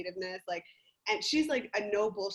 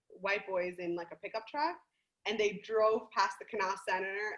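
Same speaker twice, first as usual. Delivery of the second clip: ordinary talk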